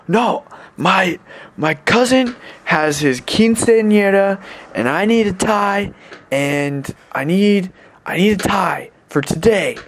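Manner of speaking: put-on high, slow drunken pleading; imitated drunk guy. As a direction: drag out a drugged-up man's explanation. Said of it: "drunk and drugged up".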